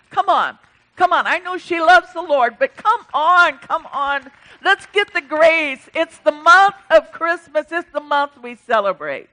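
loud, high urging; rally the crowd to come forward; rousing call. Real, not acted. Pastor calling people down for grace, loud and rousing.

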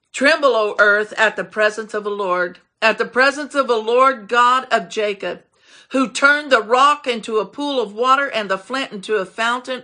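forceful, ringing scripture reading; commanding proclamation. Real, not acted. Preacher repeats the psalm's command to church, forceful proclamation. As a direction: declaim it with weight on each phrase.

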